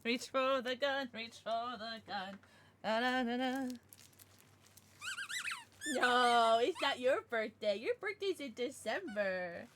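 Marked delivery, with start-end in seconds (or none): none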